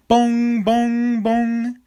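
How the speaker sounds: high, loud sung bong like a school bell; mock school bell chime; bell imitation. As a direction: ring it out like the bell.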